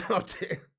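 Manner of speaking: ordinary delivery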